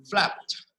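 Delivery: flapped as one short beat, "flapt"; one stressed syllable. Shown as a demonstration. Teacher models flapped for students as one stressed syllable. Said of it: "We pronounce it as flapped".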